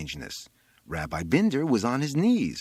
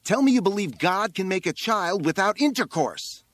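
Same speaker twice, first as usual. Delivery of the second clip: high, loud insistence; shouted demand; 11 semitones higher, 5 dB louder than usual. A narrator's reading. Boy on the roof catechizing the rabbi, shouted demand.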